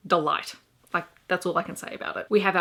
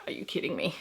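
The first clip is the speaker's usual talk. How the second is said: a soft whispered are you kidding me; incredulous whisper; 3 semitones lower, 6 dB quieter, faster than usual. Retold or imitated. Reviewer reacting to the hero's real name, in an incredulous whisper. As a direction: breathe it out, no voice, in disbelief.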